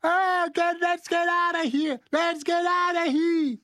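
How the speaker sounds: high panicked yelling. Retold or imitated. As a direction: yell to get out, high and urgent. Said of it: "you can hear Sam yelling".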